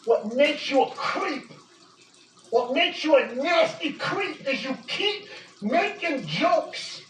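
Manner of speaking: ordinary talk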